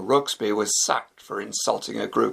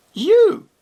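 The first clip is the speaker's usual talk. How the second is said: incredulous; 8 dB louder than usual. Acted. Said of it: "said Gemma".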